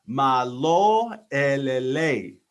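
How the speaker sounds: "Malo elele" very slow and loud, each syllable MAH-lo eh-leh-LEH; slow Tongan greeting. Shown as a demonstration. Teaching listeners the Tongan greeting, slow and clear. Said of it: "we're gonna take this one slow".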